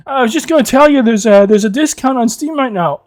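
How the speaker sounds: lightly mimicked friend explaining himself; meek friend.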